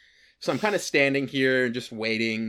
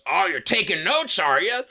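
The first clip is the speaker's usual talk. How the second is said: higher-pitched mock challenge; accosting goblin man; 6 semitones higher, 4 dB louder than usual. Goblin man confronting him on the street, high mock challenge. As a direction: put on a strange man's raised, needling voice. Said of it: "This man walks up to me. He goes".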